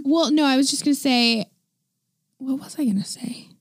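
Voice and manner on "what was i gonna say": whispered to self. Real, not acted. Forgets her point mid-sentence.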